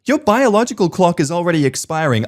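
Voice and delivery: high sneering mother voice on biological clock; mocking nagging mum. Mum lecturing the wife about her clock, high and mocking. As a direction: pitch it high and preachy like the pushy mum. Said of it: "my mum looked at my wife and said".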